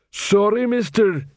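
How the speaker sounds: high-starting, heavy-worded troll voice. A narrator's reading. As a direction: play a simple troll apologising. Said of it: "Since he was a troll".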